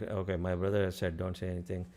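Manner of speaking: a hint of a resigned younger brother, murmured; quiet resigned inner voice. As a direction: mutter it to yourself, a little down. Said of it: "He was like".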